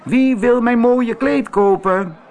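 high, loud calling out again; loud market call. Acted. Antonio roept opnieuw over de markt: luid roepend. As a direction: shout it down the market. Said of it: "terwijl hij riep".

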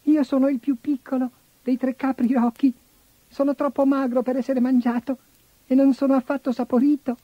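put-on high squeaky kid goat; tiny shrill bleating voice; squeaky little goat voice. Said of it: "vocina stridula".

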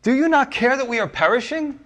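high, pleading cry of panicked sailors; frightened disciples. Retold or imitated. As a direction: plead with the sleeping teacher, alarmed. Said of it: "and said to him".

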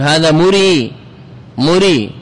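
مري ending in a long held ī; final ي restored. Shown as a demonstration. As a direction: stretch the last vowel of مري. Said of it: "لزم رد الياء".

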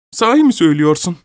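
high, quick outburst of disbelief; crying out. A narrator's reading. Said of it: "diye haykırdı kadın".

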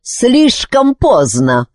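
slow, high, self-satisfied verdict; gloating and drawn out; smug. A narrator's reading. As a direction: savour it slowly. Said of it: "с довольным видом пробормотал ведьманюх".

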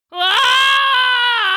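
long, loud, high howl; hollered yelp of pain. A narrator's reading. King stung on the rump, hollering in pain. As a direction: holler one sustained high cry of pain. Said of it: "the king hollered".

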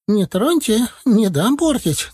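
high, slow aghast granny; protesting old healer. A narrator's reading. Целительница защищает мамкин горшок, высоким протестующим голосом. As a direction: plead for the heirloom pot.

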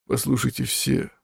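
put-on faint dying old man; softened, failing voice; weak. A narrator's reading. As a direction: feebly ask for attention. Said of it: "слабо проговорил он".

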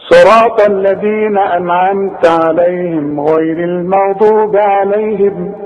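slow measured chant with long held vowels; Quran recitation. Shown as a demonstration. Teacher replays what was read for students, Quranic chanting. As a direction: recite slowly, drawing out each verse ending. نسمع بقى اللي قريناه.

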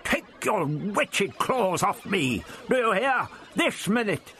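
high, slow indignant roar; the doctor roaring at the Zarbi, high and slow; roaring old man. A narrator's reading. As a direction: bellow at his captors, outraged.

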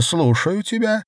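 very high-pitched man on phone; high vibrating voice. A narrator's reading. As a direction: lift the voice far up, attentive. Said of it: "высоким вибрирующим голосом".

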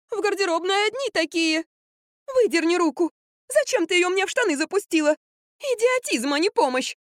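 very high, indignant whining voice; whiny quarrel. A narrator's reading. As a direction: scold your helpers in a high squeal. Stuck women squabbling over jeggings. (высокое капризное возмущение)

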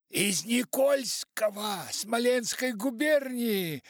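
high, loud shouting down the line; distant phone caller. A narrator's reading. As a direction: make yourself heard over a bad connection. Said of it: "ответила трубка".

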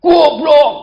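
loud, high yell of an insult; angry shout. Shown as a demonstration. Preacher shows how angry people talk up close, explosive shout. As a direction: yell it as if enraged at someone close. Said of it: "deket kok teriak".

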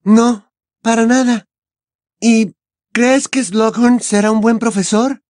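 put-on high, bright girl's voice; quick, evasive schoolgirl; Hermione. Hermione speaking.

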